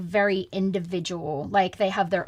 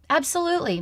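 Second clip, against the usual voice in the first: eager yes; 8 semitones higher, 3 dB louder than usual. Retold or imitated. Eager yes to singing offer.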